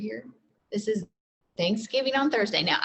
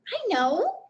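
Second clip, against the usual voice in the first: higher Annie voice; a light, raised girl's voice; eager little girl; 10 semitones higher than usual. Acted. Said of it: "said Annie".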